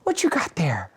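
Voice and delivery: breathy whispered high mom question; imitated mom. Mom asks the dog what it has, imitated whispery voice. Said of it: "and my mom goes".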